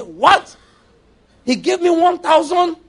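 high, loud mock astonishment; mock amazement. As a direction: gasp at the huge gift. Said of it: "Like I said".

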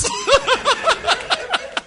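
high, rolling laugh; sustained high-pitched laughter; real laughter. Real, not acted. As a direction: crack up after the punchline. Laughing at the final punchline.

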